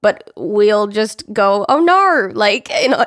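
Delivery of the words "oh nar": drawn-out Australian "Oh, nar" with a high lift; over-the-top Australian "nar" for no; Australian accent. Shown as a demonstration. Hosts joking about their accent habit, in an exaggerated Australian accent. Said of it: "it's an Australian accent".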